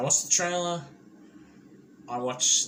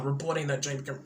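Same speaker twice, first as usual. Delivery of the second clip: ordinary talk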